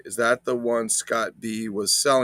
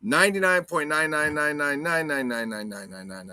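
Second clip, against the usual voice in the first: mock announcer; 4 semitones higher than usual.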